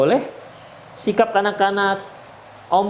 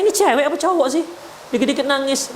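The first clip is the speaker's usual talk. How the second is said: quick, higher mocking jab at a crybaby man; mocking scorn; 5 semitones higher, faster than usual. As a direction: scoff at him like a scornful wife. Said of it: "Jangan katakan". (ejekan cepat dan sinis)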